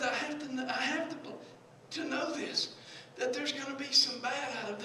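ordinary delivery

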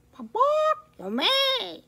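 high, loud squawking hen; storybook hen, high clucks; clucking hen. A narrator's reading. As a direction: cluck it out like a chicken. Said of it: "she clucked".

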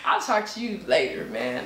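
laughing take on a casual guy; put-on guy voice with laughter in it; Jerron impersonation. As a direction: toss off a dismissive guy impression. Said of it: "what's your Jerron impersonation".

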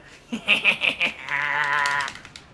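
long, breaking-out laugh; real laugh. Real, not acted. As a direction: laugh at another player's blunder. Laughs at wrong spawn.

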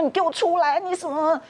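shrill-free hammed-up shout of the sister at the door; imitating a yelling sister. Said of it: "他在外面大吵大闹". (模仿姑姑在病房外大吵大闹，高声喊叫)

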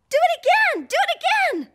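high, loud, thrilled call; excited cheer. Real, not acted. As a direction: cheer them on to go again.

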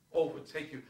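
ordinary talk, nothing marked

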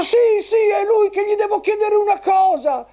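put-on high squeaky old grandpa puppet; squeaky shrill puppet voice. Grandpa eager reply.

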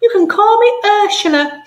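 very high, grand falsetto; grand rich lady. Acted. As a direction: introduce yourself like a grand dame. Lady voice again.

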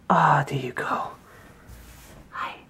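soft whispered relief. Real, not acted. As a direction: whisper it gently. Soothing waking toddler.

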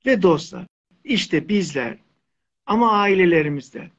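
ordinary talk, nothing marked

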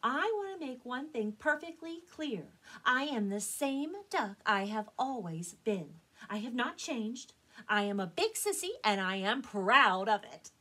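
firm, loud, proud declaration; speaking his mind. Acted. Elmer addressing the whole flock, proudly firm. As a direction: declare it deliberately, building in volume to the end. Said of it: "then spoke his mind".

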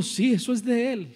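acted anguished testimony, high and loud; emotional weeping manager. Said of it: "empieza a llorar, inconsolable". (padre conmovido que suplica, voz alta y aguda)

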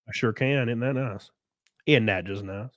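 normal talking voice

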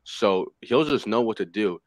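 usual speaking voice

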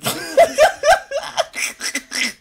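high, breathless cackle; real high-pitched laughing fit; wheezing laugh. Real, not acted. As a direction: crack up helplessly. Cracking up at game character.

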